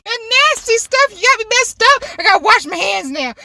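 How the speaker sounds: high, loud mock panic; freaking out. Mom desperate to wash off sanitizer, freaking out. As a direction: imitate mom's disgusted, high-pitched fluster. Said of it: "She just freaked out".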